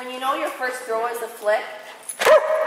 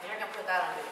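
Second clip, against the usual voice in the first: laughing; 2 semitones lower, 6 dB quieter, much faster than usual. Real, not acted. Laughing at own mistake.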